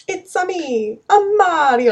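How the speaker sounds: very high mock Italian accent; Mario. Shown as a demonstration. Co-host shows her go-to Italian line, mock Italian accent. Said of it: "If I had to do an Italian accent".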